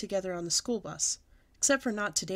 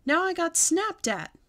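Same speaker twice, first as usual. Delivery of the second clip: indignant whine; 9 semitones higher, 6 dB louder, slower than usual. A narrator's reading. Child complaining after being scolded.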